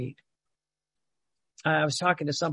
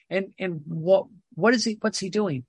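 ordinary talk, nothing marked

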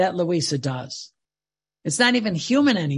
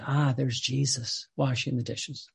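tender fatherly voice; 6 semitones lower, 6 dB quieter than usual. Retold or imitated. God the Father watching Louisa, soft and fond. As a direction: lower and softer, a hint of a doting father. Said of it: "the father would say".

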